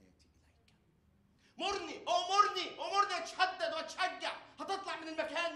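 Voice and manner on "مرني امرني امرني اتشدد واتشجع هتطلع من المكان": preaching at full force. Acted. Preaching climax.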